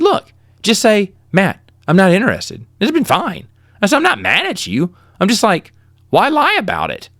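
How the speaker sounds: retold mild exasperation; exasperated plea. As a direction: push the question up in disbelief. Frustrated plea to rejecting girl.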